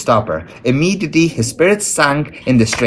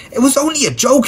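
very high-pitched, defensive outburst; exasperated mock roar, more pitch than volume; faster than usual. A narrator's reading. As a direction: protest hotly, voice shooting up. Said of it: "he roared".

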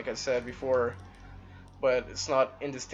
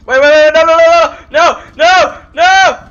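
high frantic repeated no; panicked screaming; 14 semitones higher, 22 dB louder, slower than usual. Real, not acted. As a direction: yell no over and over in panic. Gamer panics as he dies.

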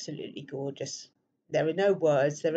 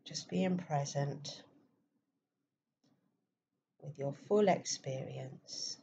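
guided meditation; 6 dB quieter than usual. Acted. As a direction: softly guide listeners into stillness, leaving space between phrases.

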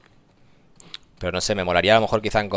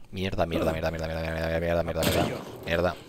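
cursing under breath; 5 semitones lower, 8 dB quieter than usual. Real, not acted. Repeated cursing under fire.

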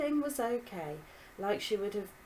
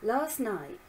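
ordinary delivery

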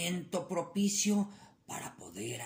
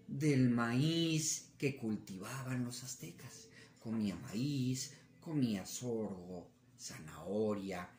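ordinary delivery

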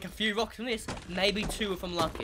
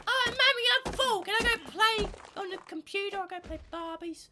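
very high mock little-girl voice; whining sisters; 4 dB louder than usual. Sisters to Mummy after one chip, high childish pleading. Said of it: "they're like".

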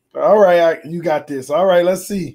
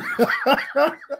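gleeful laugh; 10 semitones higher than usual. Real, not acted. Laughing after the win.

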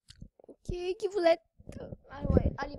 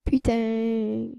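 ordinary talk

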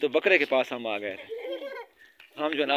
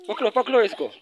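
urgent shout; 8 semitones higher, 4 dB louder than usual. Real, not acted. Urging kids to grab goat.

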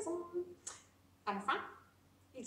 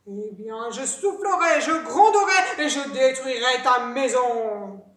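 loud threat swelling then sinking; big bad wolf menace; threatening wolf; 2 semitones lower, 10 dB louder than usual. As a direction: build to a roar on each verb. Wolf threatens second pig.

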